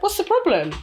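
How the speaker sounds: a high, mock-innocent what's the problem; innocent reply. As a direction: play dumb sweetly, voice up high. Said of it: "then I reacted like".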